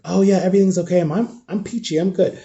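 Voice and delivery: a hint of forced cheer; sarcastic fake okay; fake cheerful. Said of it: "I'm not gonna be fake".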